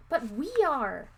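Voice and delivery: put-on boy protesting to the clerk, crying out. A narrator's reading. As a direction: insist desperately. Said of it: "Eddie cried".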